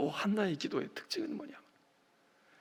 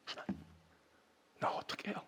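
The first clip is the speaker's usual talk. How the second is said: hushed plea; 13 dB quieter than usual. Acted. Hannah's prayer voiced.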